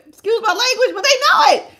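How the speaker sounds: high, laughing squeal through the words; embarrassed laughter, pitch shooting up; laughing apology. Real, not acted. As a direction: crack up after a slip of the tongue. Laughs after accidentally swearing.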